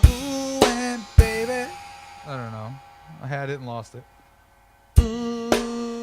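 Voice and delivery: usual speaking voice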